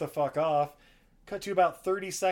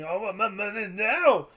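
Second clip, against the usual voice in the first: mock demand; 5 semitones higher, 6 dB louder than usual. Briggs demanding money.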